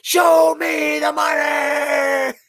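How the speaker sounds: high, slow movie shout; Jerry Maguire quote. Retold or imitated. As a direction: belt it like the famous film line. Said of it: "what was the Jerry Maguire, the movie".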